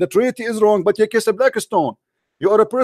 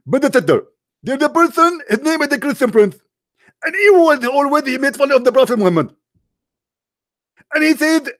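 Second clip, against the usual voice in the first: higher, pushier preacher voice throughout; mocking Zakir Naik; 4 semitones higher than usual. Debater mocks a rival preacher, in a raised pompous voice. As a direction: lampoon a TV preacher explaining away. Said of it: "he will say the following".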